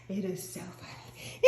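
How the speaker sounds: whispered through laughter, almost crying; wheezing with laughter; out of breath from laughing; laughing breathlessly. Real, not acted.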